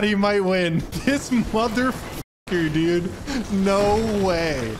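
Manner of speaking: ordinary talk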